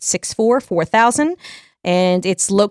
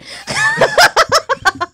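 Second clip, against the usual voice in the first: shrieking high laugh. Real, not acted.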